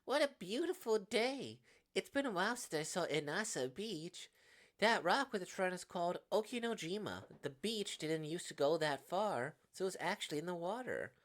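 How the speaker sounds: lightly voiced, upbeat game character; cheerful beach companion. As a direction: voice a chatty friend enjoying the outing.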